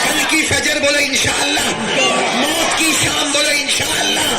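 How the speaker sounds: high, forceful preaching call; rallying pledge. Real, not acted. Preacher leading the crowd in vows to parents, high rallying cry.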